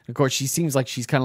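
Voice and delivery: ordinary delivery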